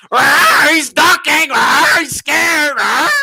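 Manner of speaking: mock a frightened boxer crying out; mock scared cries. Mocking a scared opponent.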